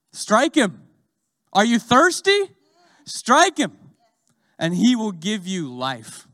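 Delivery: loud, high shouting exhortation; preaching shout. Real, not acted. Preacher urging the crowd at full force to strike him. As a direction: cry it out to the congregation.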